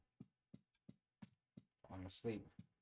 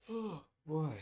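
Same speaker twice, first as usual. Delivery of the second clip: louder, higher yawn on the words; 7 semitones higher, 7 dB louder than usual. Real, not acted. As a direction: stretch it out in a big yawn. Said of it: "I am actually very tired".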